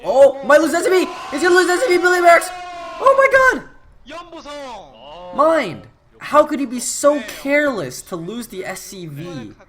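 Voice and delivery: high, loud, frantic yelling; excited shouting. Real, not acted. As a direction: scream it out in panic. Caster reacts to worker loss.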